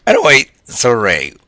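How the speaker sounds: normal talking voice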